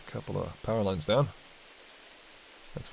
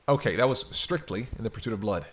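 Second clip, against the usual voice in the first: ordinary talk, nothing marked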